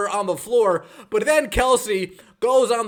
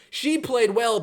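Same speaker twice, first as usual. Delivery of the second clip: normal talking voice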